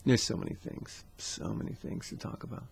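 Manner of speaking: breathy whisper to himself; whispered aside. Real, not acted. Vlogger whispering to viewers, overwhelmed.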